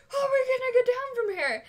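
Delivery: high, loud, half-laughing panicked wail; freaking out. Retold or imitated. Stuck high on the ski lift, she asks Zach in a laughing panic. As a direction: squeal it, laughing and scared at once. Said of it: "freaking out".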